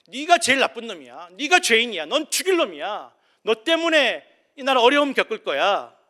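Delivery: the prophet Nathan condemning King David, furious; prophet's rebuke. Acted. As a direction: thunder the accusation at the king. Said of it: "나단 선지자가 찾아와서 다윗에게 말합니다".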